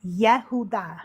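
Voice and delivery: Yehudah said as yeh-HOO-dah; Hebrew pronunciation. Shown as a demonstration. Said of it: "related to the name Judah".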